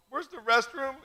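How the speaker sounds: high, loud panicked escape line; mock panic. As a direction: blurt it out like you need to run. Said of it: "and then go".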